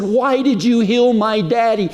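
high, strained, anguished plea; retold tearful wail of a question; crying out. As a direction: cry it out to God, pitch far up. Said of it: "as I cried, just cried, tears".

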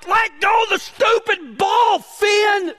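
high mock yell at the dog, half-sung. The other dogs yelling at Finn, high mock shout. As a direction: shout it like the whole pack is fed up. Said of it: "all the dogs are like".

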